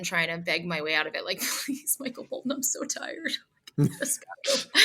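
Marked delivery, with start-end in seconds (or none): 1.7-4.8 whiny pleading. Retold or imitated. Begging her way out, exhausted.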